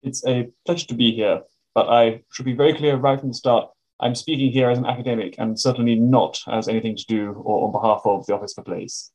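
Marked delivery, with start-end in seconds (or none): none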